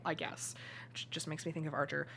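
whispering under her breath; whispered aside. Real, not acted. Whispered aside about Archer.

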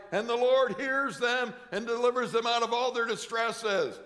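loud, high preacher's cry; fervent preaching. Acted. Preacher proclaiming scripture, fervent and loud. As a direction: proclaim it to the congregation.

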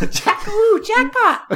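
very high, drawn-out game voice; pinball callout impression. Host doing his twenty-four impression, high slow callout. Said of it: "my twenty-four impression is pretty good".

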